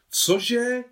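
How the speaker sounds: sharp high shriek of alarm; agitated cry. Acted. Chadídža cries out in shock, high and loud. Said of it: "vykřikla rozrušený Chadídža".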